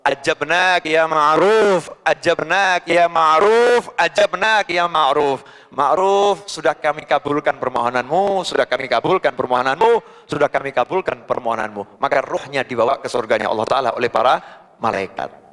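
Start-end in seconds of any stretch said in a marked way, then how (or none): 0.1-12.0 disembodied heavenly voice. Acted. Unseen voice grants Ma'ruf's prayer, slow solemn intoning. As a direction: intone it slowly, sinking lower as it repeats. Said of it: "terdengar suara tanpa ru-, rupa".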